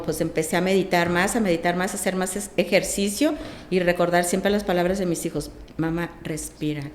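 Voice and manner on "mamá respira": soft reminder. Retold or imitated. Recalls children's words to calm herself.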